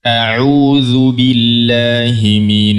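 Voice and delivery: slow, chanted recitation; slow tajwid recitation; Quranic recitation. Shown as a demonstration. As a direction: recite it slowly and melodiously for students to follow. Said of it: "Saya baca".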